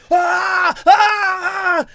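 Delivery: loud, high howl of pain; high, agonized comic yell; groin-kick howl. Acted. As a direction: act out a shot to the groin, screaming. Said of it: "acting out this shot to the groin".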